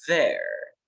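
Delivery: "there" drawn out slowly as one syllable, ther; slow model contraction. Shown as a demonstration. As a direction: stretch "there" so the class hears they're. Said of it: "En lugar de decir they are, digo".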